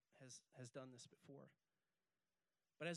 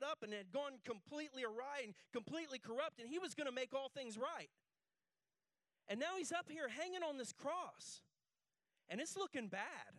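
raised, loud preaching voice; full-force preaching; 9 semitones higher, 7 dB louder than usual. Acted. Pastor preaching the crucifixion to the congregation, loud and raised. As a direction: preach it big to the crowd.

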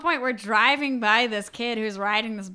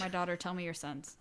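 soft, low pretend confessor; 4 semitones lower, 12 dB quieter than usual. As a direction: invite the confession softly, priest-like.

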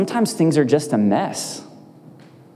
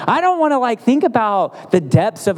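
mock whine; 10 semitones higher than usual. Mock whining about sad movie.

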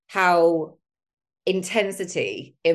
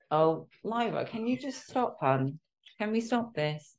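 soft, higher hushed plea to the dog; hushed aside to the dog; 4 semitones higher, 7 dB quieter than usual. Real, not acted. Host to her dog Lyra, staring at her, hushed plea. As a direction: quietly shoo the pet off mid-recording.

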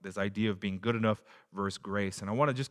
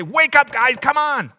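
sharp, much higher and louder rebuke; stern correction; 14 semitones higher, 11 dB louder, slower than usual. Retold or imitated. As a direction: snap them awake like a scolding teacher. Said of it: "not with a stern correction".

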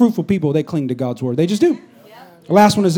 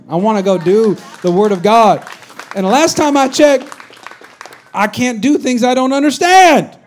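rising louder, slowing on the last line; preaching crescendo; 5 semitones higher, 6 dB louder than usual. Real, not acted. Preacher building to a loud climax for the congregation. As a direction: build the point up to a loud peak.

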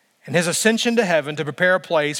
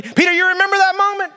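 much higher and louder, stressing "that"; climactic high outcry; 7 dB louder than usual. Pretend. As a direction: hit the peak of the reproach. Repeated question at the climax.

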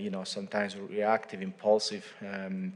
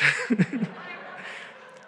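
helpless laugh; 12 semitones higher than usual. Real, not acted. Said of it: "Sorry, sorry about that".

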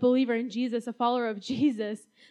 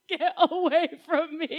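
laughing mock panic; 5 semitones higher, 4 dB louder than usual. Child shooing caterpillars.

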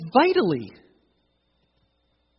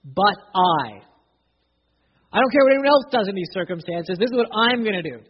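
louder, higher, fast, firm vow; emphatic declaration; 4 semitones higher, 9 dB louder than usual. Acted. The psalmist vowing to God, loud and emphatic. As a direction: declare it with full conviction. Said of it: "puts "but I" in the emphatic position".

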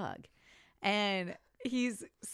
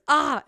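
mock angry yell; 6 semitones higher, 12 dB louder than usual. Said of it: "And he goes".

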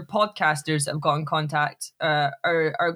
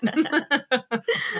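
laugh; 8 semitones higher than usual. Real, not acted. Laughing about her accent practice.

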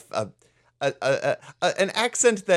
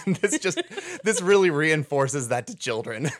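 ordinary talk, nothing marked

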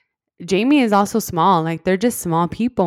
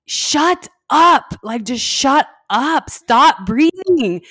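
loud, drawn-out exasperated yell; retold blow-up; 7 semitones higher, 6 dB louder, much slower than usual. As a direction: blow up, stretching out each command. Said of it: "when I blew up as well".

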